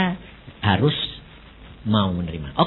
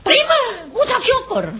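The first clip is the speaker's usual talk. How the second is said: shouted exhortation. Real, not acted. Pastor urging congregation to give thanks, shouting. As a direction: burst out preaching at full force.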